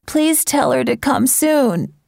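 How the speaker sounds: desperate cry. A narrator's reading. Feverish sister begging for her mother to come, desperate. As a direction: plead slowly like a sick, frightened girl. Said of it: "Mary Ann cried desperately".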